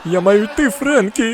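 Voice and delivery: high, hammed-up desperate goodbye; mock panicked farewell. Dad pranks Frankie with goodbye, mock desperation. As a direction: cry out a fake last farewell.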